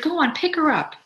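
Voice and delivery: high, loud command; urgent order. A narrator's reading. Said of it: "she ordered".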